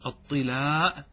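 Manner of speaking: "الطلاء" said as tilaa, with kasra on the ta and a long final a; الطلاء بكسر الطاء مع المد. Shown as a demonstration. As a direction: say "الطلاء" as ṭilāʾ, the ta with i. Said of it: "بكسر الطاء مع المد".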